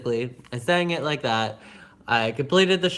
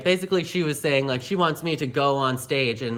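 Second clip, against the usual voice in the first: ordinary talk, nothing marked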